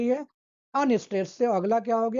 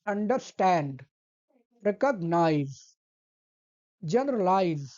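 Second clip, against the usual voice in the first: Understand, recognise, generalise with two light syllables, then a long, falling final one; stress on last syllable; 3 semitones lower, slower than usual. Shown as a demonstration. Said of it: "शुरुआत के दोनों". (आख़िरी अक्षर पर लंबा ज़ोर)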